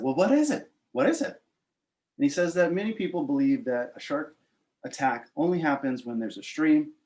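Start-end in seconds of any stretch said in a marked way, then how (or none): 0.0-1.4 high-pitched, insistent asking; eager questioning. Retold or imitated. Surfer begs his friend for the one survival tip, eager. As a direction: press eagerly for the answer, pitch jumping up.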